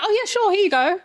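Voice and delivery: high, polite mock agreement; feigned politeness. Acting out polite locals serving her, feigned politeness. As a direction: humour them with a high, airy yes. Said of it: "literally be like".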